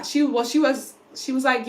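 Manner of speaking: ordinary talk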